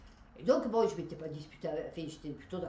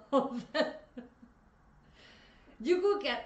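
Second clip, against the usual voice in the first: laughing; 4 semitones higher, 5 dB louder than usual. Real, not acted. Laughs describing the group.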